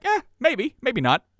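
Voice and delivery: shrugging, dismissive 'eh'; mock nonchalance. Host mimics Trump answering the protesters, nonchalant. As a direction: brush it off with a verbal shrug. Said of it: "so nonchalant about it".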